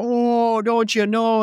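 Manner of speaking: put-on loud, high Wisconsin-style "Canadian" accent; mock Canadian accent. Imitating people teasing Canadians, exaggerated Canadian accent. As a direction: overdo a folksy northern Midwest accent. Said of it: "that's like Wisconsin".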